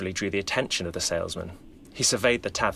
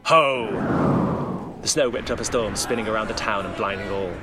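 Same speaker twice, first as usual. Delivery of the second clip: booming ho; 2 semitones higher, 3 dB louder, slower than usual. Acted. Santa unleashing power against the mob, a booming ho. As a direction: summon the storm with each ho.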